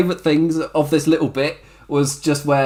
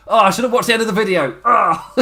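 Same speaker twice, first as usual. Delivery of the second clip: high, rushed retold frustration; mock angry outburst; 7 semitones higher than usual. Retelling the wrestler missing the end of the video, mock angry. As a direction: imitate an angry, exasperated groan of regret. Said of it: "He just gets so angry".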